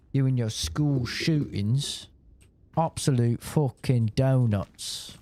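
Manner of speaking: a light, slowed-down mock British sneer; British accent. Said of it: "that guy's British".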